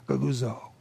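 low muttered goguzo, like a sullen grumble; low complaining murmur of goguzo; muttered grumbling sound. Shown as a demonstration. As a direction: grumble goguzo under your breath. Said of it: "That's what's happening".

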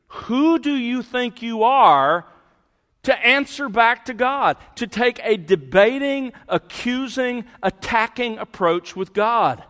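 forceful preaching rebuke. Acted. Preacher confronting the congregation with a rising, forceful rebuke. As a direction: thunder the challenge at the proud questioner.

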